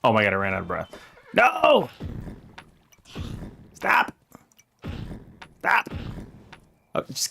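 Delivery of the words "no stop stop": much higher whiny plea; high mock-desperate pleading; high-pitched whine. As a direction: whine at the horse to stop. Pleading with tired horse.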